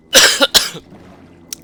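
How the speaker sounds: one loud hacking cough; sharp cough. Real, not acted. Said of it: "I'm also gonna be coughing a lot".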